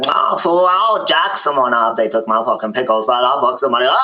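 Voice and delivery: a hint of Mexican accent, threatening. Sue vowing revenge for pickles, light Mexican accent. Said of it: "She's Mexican, by the way".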